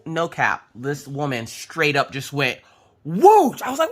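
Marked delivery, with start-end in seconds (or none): 3.0-3.9 loud high-pitched whoosh; a quick, loud whoosh shooting upward; sudden whoosh. Retold or imitated. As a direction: mimic a sudden whooshing jab. Acting out the swab going in.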